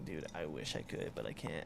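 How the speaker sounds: quiet, low and sorry; soft apologetic. Retold or imitated. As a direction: let him down softly. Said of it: "I was like".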